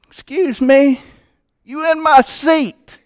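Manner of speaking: very high, slow, nasal-free put-on voice of an indignant woman; prissy church lady. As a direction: squeak it out like a haughty pew owner. Mimicking the blue-haired woman.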